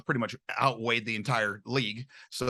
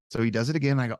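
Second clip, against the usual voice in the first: normal talking voice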